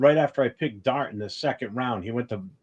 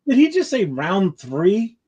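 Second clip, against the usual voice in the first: disbelief; 8 semitones higher, 5 dB louder, slower than usual. Real, not acted. Shocked at a draft pick.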